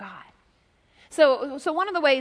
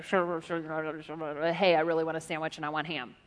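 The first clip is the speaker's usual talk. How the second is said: lower, fast, mumbled run of talk; garbled speech; 7 semitones lower, 6 dB quieter than usual. Shown as a demonstration. Preacher shows his church how unheard talk sounds, mumbled. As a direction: show how talk sounds when you aren't tuned in. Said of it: "I can't understand what they're saying".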